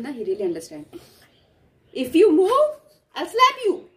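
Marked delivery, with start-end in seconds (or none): 1.9-3.9 loud high mock threat. Real, not acted. Mother threatening her dog in play, loud and high. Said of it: "when I'm scolding him".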